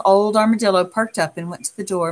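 ordinary delivery, nothing marked

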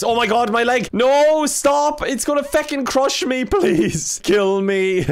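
high, loud pleading; hammed-up panic. Player begs as robot's leg gets crushed, mock panic. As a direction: cry out as if being crushed, half laughing.

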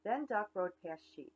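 ordinary delivery, nothing marked

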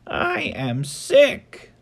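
high, small bird voice; a high bird voice for the kids. Acted. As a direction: squeak it out like a little sick bird. Bird speaks in picture book.

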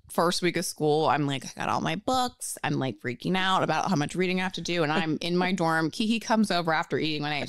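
normal talking voice

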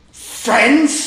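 father elephant scoffing at his son; scornful snort. A narrator's reading. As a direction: scoff at the very idea. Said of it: "snorted Daddy Heflapunt".

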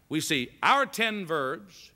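normal talking voice

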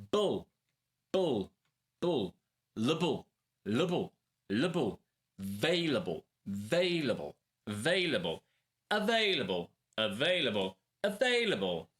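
"Bull", "Le bull", "Vailable", then "Available", each thrice with falling tune; back-chained word drill. Shown as a demonstration. Teacher drills a hard word from the end, measured repetitions. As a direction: build "Available" up from its last syllable in separate repeats. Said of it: "Zacznij od końca".

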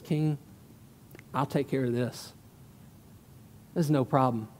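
low, soft, understated assurance; shepherd boy David. Acted. David telling the king he'll handle it, understated. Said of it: "He goes up to the king".

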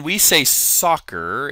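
usual speaking voice

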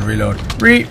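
ordinary talk, nothing marked

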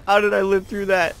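high, loud laughing triumph; excited shout. Real, not acted. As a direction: whoop with disbelieving joy. Escaping alive, elated.

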